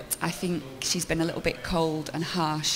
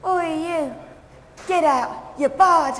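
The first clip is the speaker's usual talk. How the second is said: high shouted pub landlady; Queenie Windsor; 12 semitones higher, 8 dB louder, much slower than usual. Acted. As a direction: bark like a fierce landlady. Landlady throws someone out.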